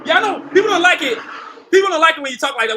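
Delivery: loud defiance. Real, not acted. Creator answering haters of his confidence, loudly defiant. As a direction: fire back loudly, well above normal pitch.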